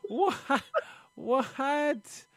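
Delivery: high-pitched, aghast reaction; incredulous and hammed-up; appalled disbelief. Retelling his dawning horror.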